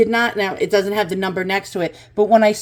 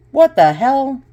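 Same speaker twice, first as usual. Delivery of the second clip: stunned surprise; 5 semitones higher, 4 dB louder than usual. Real, not acted. Shocked by high price again.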